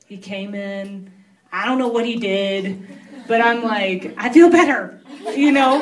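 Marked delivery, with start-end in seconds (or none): 4.2-5.8 laughing it out, louder and higher; real laugh of relief. Real, not acted. Relief after prayer.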